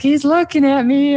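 put-on high whiny child tattling; whiny tattling little sibling. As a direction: whine it like a tattling kid. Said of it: "So it's kinda like that".

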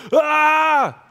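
high, loud hammed-up scream; mock panicked scream. Freaked-out believer overwhelmed by the news, mock panicked scream. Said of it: "where he's freaked out".

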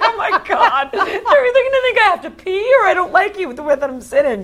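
high, fast, laughing worry; laughing panic. Retold or imitated. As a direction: gush out the worry, giggling. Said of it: "I'm like".

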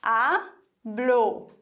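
hablo in two parts, stressed A, pause, blo; syllable by syllable. Shown as a demonstration. Teacher counts two syllables, splitting hablo as A, blo. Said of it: "Betonung auf der vorletzten Silbe".

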